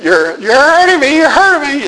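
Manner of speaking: high-pitched retold scream of pain; imitating sister's scream. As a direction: mimic a girl shrieking while being slapped. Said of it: "was screaming".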